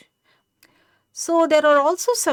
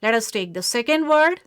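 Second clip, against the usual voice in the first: ordinary talk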